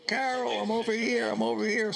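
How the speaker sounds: retold call to a sister, pitched high. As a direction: hail his sister down the hall, imitating it. Calling out to his sister.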